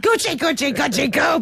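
put-on light baby-talk coo. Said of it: "like, baby voice".

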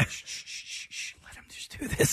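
hushed shushing whisper. Retold or imitated. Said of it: "you guys all were sitting there like".

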